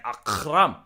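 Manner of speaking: "Akram" with a rasped throaty k; guttural Arabic kh. Shown as a demonstration. Showing the Arabic sound, "Akram" with a guttural k. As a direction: scrape the middle of "Akram" in the throat. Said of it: "yeah, it's like".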